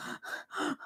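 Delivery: soft gasp of dread; acted gasp; mock gasp. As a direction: draw in a breath in mock dismay. Retold reaction to children fighting.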